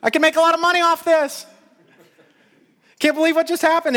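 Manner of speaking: very high, loud squealing witch; hammed-up witch squealing at her own success; little witch lady. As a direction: shriek it in amazed comic falsetto. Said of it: "This little witch lady was like".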